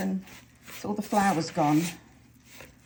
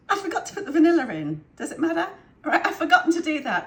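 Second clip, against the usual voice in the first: laughing; 6 semitones higher, 7 dB louder than usual. Real, not acted. Laughing at own mistake.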